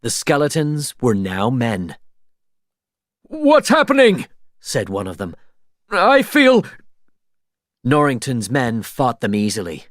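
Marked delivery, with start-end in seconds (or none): 3.2-4.3 high, loud alarmed pirate; panicked cry. A narrator's reading. Pirate reacting to his curse lifting, high and panicked. 5.9-6.6 high, loud startled pirate; alarmed cry. A narrator's reading. Pirate feeling his body change, high and startled. Pirate feels curse lifting.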